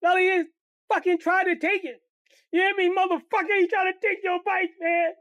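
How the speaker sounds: put-on big kid furious after the fight; angry crying. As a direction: vent the hurt in a shout. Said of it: "Kenny crying in anger".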